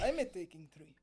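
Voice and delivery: put-on Russian accent. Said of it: "Russian accent".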